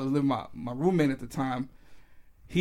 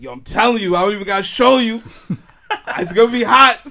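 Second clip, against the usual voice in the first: hyped insistence; 7 semitones higher, 12 dB louder than usual. Retold or imitated. Hyped pitch to the plug.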